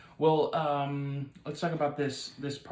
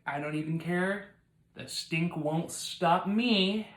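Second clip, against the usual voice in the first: building to a higher, slow declaration; defiant resolve; 5 semitones higher, 4 dB louder, slower than usual. Acted. Jesus answering Martha about the stink, boldly defiant. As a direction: stretch it out, undeterred.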